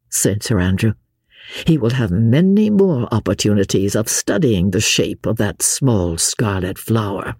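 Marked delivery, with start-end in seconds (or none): 1.7-7.4 lightly voiced gentleman, lower; earnest young Englishman. A narrator's reading. Gentleman explains his leader's name to mademoiselle, low and earnest. Sir Andrew replies.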